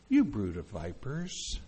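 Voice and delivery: soft, unhurried, low-pitched address; gently understated scolding, no bite; nice, mild reading. Shown as a demonstration. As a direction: say it mildly, as if paying a compliment. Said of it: "you think that's why he said it".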